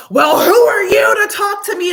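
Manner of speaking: high, loud, shrieking outrage; hammed-up shrill indignation; mock outraged lady. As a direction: shriek it like the entitled customer. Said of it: "And she's like".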